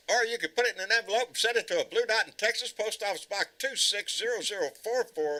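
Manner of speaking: loud, high radio ad read; announcer voice. Acted. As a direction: pitch the address like a commercial. Reads an address like an ad.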